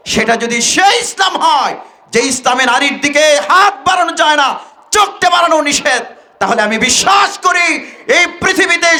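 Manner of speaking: high, impassioned declamatory cry. Retold or imitated. Woman crying out to the Taliban member, fervent. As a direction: proclaim it fervently in her words. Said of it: "ওই নারী চিৎকার করে বলছে".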